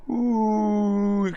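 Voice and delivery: long, high, steady cooing "ooh"; chesty ooh. Shown as a demonstration. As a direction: hold a sustained ooh sound. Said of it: "like, sounds from the chest".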